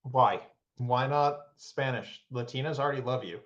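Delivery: ordinary talk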